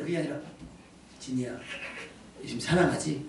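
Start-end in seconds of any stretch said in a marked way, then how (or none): 1.2-3.2 low, soft, tender asking; gentle question. Acted. Pastor gently waking dozing Jini, tender question. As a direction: coax kindly, without any scolding. Gentle contrast to the startling shout.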